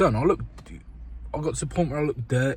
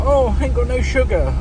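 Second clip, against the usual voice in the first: high, loud mocking whine; mocking the bloke; 10 semitones higher, 7 dB louder than usual. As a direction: pull a face and mock his lazy excuse. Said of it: "And he was like".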